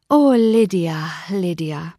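slow, drawn-out lament, sinking on the repeat; rueful lament. Acted. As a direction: sigh the sister's name in dismay. Elizabeth laments her sister Lydia.

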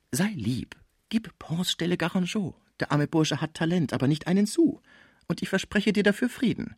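high coaxing voice, opening in a whisper; dancer, coaxing. Acted. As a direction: coax him confidentially as the dancer. (schmeichelnde Tänzerin, hohe Stimme)

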